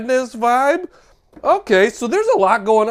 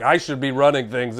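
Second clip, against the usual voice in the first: low, self-assured smugness; smug know-it-all; 7 semitones lower, 3 dB quieter than usual. Acted. Cover character claiming he should run things, smug. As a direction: state it as the one who thinks he's smartest. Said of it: "he thinks he's pretty smart".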